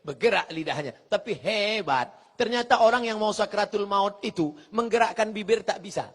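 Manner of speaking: ordinary talk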